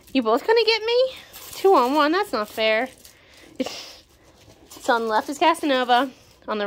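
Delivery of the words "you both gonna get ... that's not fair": mock outrage. Puppies gang up on her.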